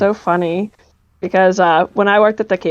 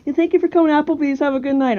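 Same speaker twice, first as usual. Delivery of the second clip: imitated customer service; 7 semitones higher, much faster than usual. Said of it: "suave customer service voice".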